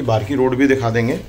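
normal talking voice

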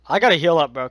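loud urgent call to the team; cover me shout. Real, not acted. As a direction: shout it while under fire. Hurt mid raid, asks cover.

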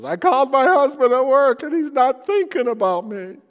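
high, loud, wailing wife voice; upset young wife. Retold or imitated. Pastor imitating a crying wife in his office, high and loud. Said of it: "And she goes".

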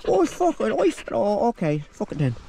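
high huffy dog voice; voicing the dog giving up. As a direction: sulk like the dog losing the argument.

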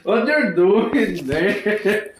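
high, loud question through laughter; laughing. Real, not acted. Cracking up at the listener, laughing question. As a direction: drag the words out while laughing hard.

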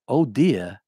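"oh dear" in a Boston accent, "dear" ending "dee-ah"; Boston dropped R. Shown as a demonstration. Showing what the professor wanted lost, Boston accent. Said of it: "if I didn't move my R's from where they were".

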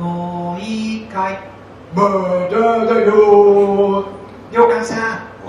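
put-on high, drawn-out child's call. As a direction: call out like a child playing hide-and-seek. Children calling in hide-and-seek.

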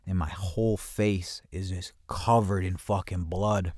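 usual speaking voice

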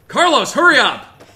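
high, loud calling out; impatient shout. Real, not acted. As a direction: yell for him to hurry.

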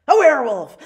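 loud, high dramatic announcement; excited reveal. A narrator's reading. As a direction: build to a big reveal. Reveal of werewolf in story.

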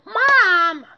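loud, high shout. Acted. Buster shouting to interrupt his rushing mother. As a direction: yell to cut her off. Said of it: "Buster began".

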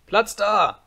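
put-on high, loud little-boy yell; commanding cry. A narrator's reading. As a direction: shout to clear the way. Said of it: "schrie Häwelmann". (herrischer Schrei)

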